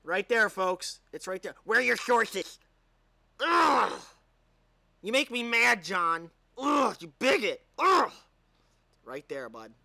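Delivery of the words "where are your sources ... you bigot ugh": higher, louder whiny heckler with disgusted groans; mock outraged critic. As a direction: ham up a shrill triggered critic.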